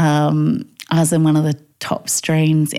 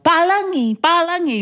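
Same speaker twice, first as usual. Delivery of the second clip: mock yell; 12 semitones higher than usual. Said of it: "people would yell".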